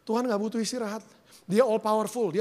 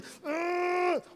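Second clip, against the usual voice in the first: long high effort hum; mimed strain of lifting a weight; straining grunt; 9 semitones higher, 3 dB quieter than usual. Acted. Lifter tearing muscle during a rep, drawn-out straining groan.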